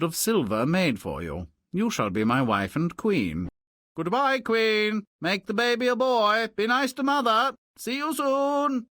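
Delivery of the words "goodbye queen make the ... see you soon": chirpy farewell. Acted. King's hurried farewell to queen.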